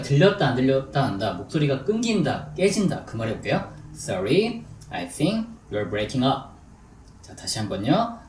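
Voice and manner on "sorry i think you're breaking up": slow, with pauses between chunks; deliberate, phrase-by-phrase English demonstration; slow drill model. Shown as a demonstration. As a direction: model it slowly for learners to repeat. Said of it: "천천히 해볼게요".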